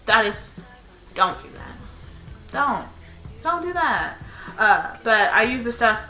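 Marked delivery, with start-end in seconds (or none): 1.1-4.2 hushed "don't do that", then higher and stressed "Don't"; emphatic warning. Shown as a demonstration. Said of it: "Don't try and style your hair".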